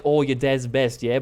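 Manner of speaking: mock Australian accent, BEST kept distinct from "dead"; imitated Australian accent. Customs guy chatting to tourists, imitated Australian accent. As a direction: mimic an Australian saying "best". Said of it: "very thick accent. Uh, like, Australian accent".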